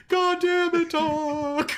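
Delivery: friend's ghost voice, imitated while laughing; mock spooky ghost voice. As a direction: ham up the ghost cursing at Doc. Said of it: "the spooky ghost voice going".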